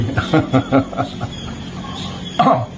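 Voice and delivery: soft unvoiced laugh of ridicule; mocking laugh. Real, not acted. Commentator taunting Rizik Sihab for staying silent, mocking laugh. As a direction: laugh quietly at the target.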